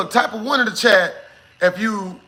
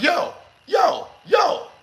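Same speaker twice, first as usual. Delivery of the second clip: sharp high yell of "yo"; startled call; 9 semitones higher, much slower than usual. Retold or imitated. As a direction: shout at him to get his attention. Shoving the guy, calling out.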